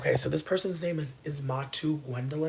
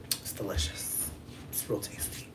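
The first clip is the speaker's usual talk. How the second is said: soft, whispery relish; hushed savoring; 5 semitones lower, 7 dB quieter than usual. Real, not acted. Savoring the Southern accent aloud.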